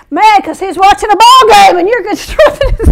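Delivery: high, loud, building to a laugh; breaking into laughter. Real, not acted. Preacher joking about devil watching a ball game, cracking up.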